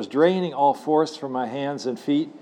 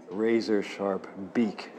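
ordinary delivery

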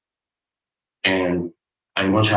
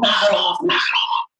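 fading echo; 11 semitones higher than usual. Retold or imitated. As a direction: let it fade like an echo. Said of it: "Now he's angry".

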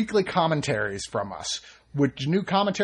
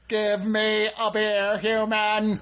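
higher, slow robot demand; 5 semitones higher, much slower than usual. Pretend. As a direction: drone out a demand for beer. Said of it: "Robots like beer".

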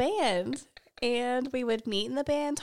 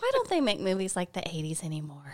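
whispering; 5 semitones lower, 6 dB quieter than usual. Real, not acted. Wistful joke about eighties movies.

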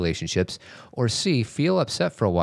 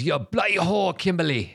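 higher English soap villain; Shane Donovan; 9 semitones higher than usual. Retold or imitated. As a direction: spit it out in an English accent. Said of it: "I can do Shane Donovan".

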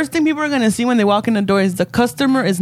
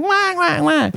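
mock crying; 7 semitones higher, slower than usual. Parents whining after being kicked out.

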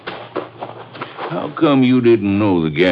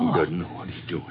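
hushed prayer, much softer; praying quietly; 10 dB quieter than usual. Acted. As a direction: murmur a prayer under threat. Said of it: "Pray louder. Let me hear you".

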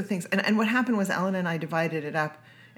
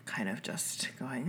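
drained, breathy whisper trailing off; tired whisper; 5 semitones lower, 9 dB quieter, slower than usual. Real, not acted. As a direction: whisper it like you're worn out. Exhausted after camp.

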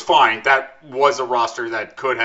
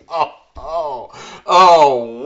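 ordinary delivery

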